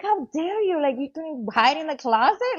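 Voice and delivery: high-pitched playful outrage; mock indignation. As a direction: scold the husband in mock offence. Mock scolding of husband, retold.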